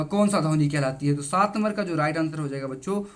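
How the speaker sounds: ordinary talk, nothing marked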